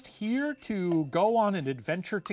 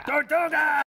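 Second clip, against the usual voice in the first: pirate shout; 6 semitones higher, 3 dB louder than usual. Pretend.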